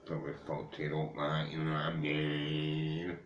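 ordinary talk, nothing marked

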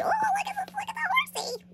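high squeaky cooing over a picture; delighted coo. Real, not acted. Vlogger spots a horse in a magazine, delighted coo.

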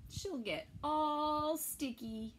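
ordinary delivery, nothing marked

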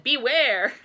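loud, drawn-out sign reading; booming storybook caution; dramatic warning. A narrator's reading. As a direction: proclaim it as a big warning. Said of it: "putting up signs that said".

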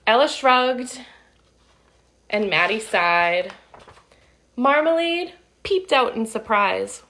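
put-on lively read-aloud for kids; storybook narration. A narrator's reading. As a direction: tell it like a picture book to children. Reading picture book aloud.

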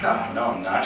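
meek denial. Retold or imitated. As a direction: mumble it like a scolded kid. Denying during argument with girlfriend.

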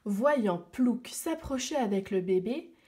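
plain reading voice

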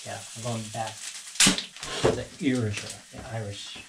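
normal talking voice

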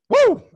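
high, loud whoop of self-hype; fired-up whoop. Real, not acted. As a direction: cheer yourself on after a rant. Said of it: "Got fired up right there".

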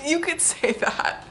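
high, laughing reply; amused giggle. Real, not acted. Laughing answer.

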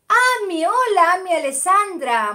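high, loud happy hello; excited greeting. Real, not acted. Greeting a viewer who just joined, excited. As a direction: welcome her with a burst of joy.

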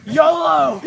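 loud imitation of a daredevil's yell; imitated reckless cry. Guessing what a friend thought mid-jump, imitated reckless cry. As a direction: holler it like someone leaping blind. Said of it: "closed his eyes and was just like".

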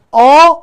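a loud آ begun with a sharp catch in the throat; glottal stop demo. Shown as a demonstration. Teacher making students feel the glottal stop: loud آ. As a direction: hit آ hard from a closed throat. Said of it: "اون لرزش صامت همزه است".

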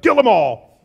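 loud, hammed-up outburst; mock wrathful shout. Preacher imagines being Jesus, mock furious shout. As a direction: shout a comic order to smite.

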